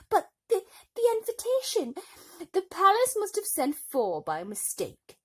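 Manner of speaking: high, loud, indignant; shrill stepmother. A narrator's reading. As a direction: protest in outrage. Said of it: "said her stepmother".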